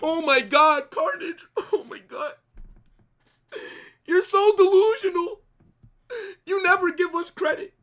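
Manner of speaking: high, whining mock crybaby voice; mock the fans in a high, crying whine; whiny Barca fan. Mocking whiny Barca fans who say he never gives credit, high and whining. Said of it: "then when I don't give you credit".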